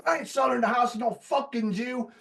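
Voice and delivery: high, strained mock rant of the old man; hammed-up old-man outburst; angry grandpa. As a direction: imitate the bigoted grandpa storming. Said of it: "kicks the chair over and said".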